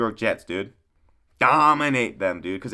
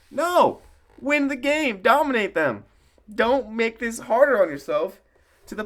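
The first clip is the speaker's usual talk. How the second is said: emphatic rant; 11 semitones higher than usual. Real, not acted. Ranting at his team.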